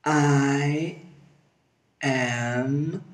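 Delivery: very slow, long-held vowels; slow motion. Shown as a demonstration. Teacher models word by word, slow motion. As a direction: stretch each word right out. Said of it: "Slow motion".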